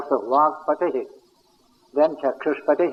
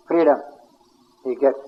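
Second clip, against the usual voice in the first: ordinary talk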